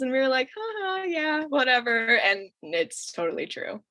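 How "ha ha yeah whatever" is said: high fake laugh dropping to a shrug; put-on ha-ha, then offhand; mock dismissive. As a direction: brush off the teasing, imitating kids. Acting kids shrugging off mom's teasing.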